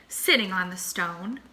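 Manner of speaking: plain reading voice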